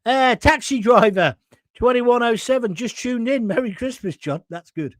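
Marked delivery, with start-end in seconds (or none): 4.5-4.9 dry, sarcastic "good"; a low, dry, sarcastic "good". Shown as a demonstration. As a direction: say "That's good." as a sarcastic jab. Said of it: "That's sarcasm".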